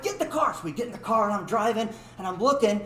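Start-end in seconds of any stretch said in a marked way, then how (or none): 0.0-0.5 urgent order. Retold or imitated. Urgent order while fleeing.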